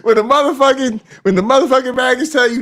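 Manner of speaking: high, loud, fast, half-laughing. Real, not acted. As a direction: push it out through laughter. Laughing, high excited rant.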